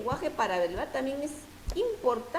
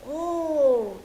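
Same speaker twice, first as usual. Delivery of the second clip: a high, louder "¡Oh!" to show an exclamation; demo exclamation; 6 semitones higher, 4 dB louder than usual. Shown as a demonstration. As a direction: sound impressed with the "¡Oh!". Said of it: "¿qué estoy diciendo".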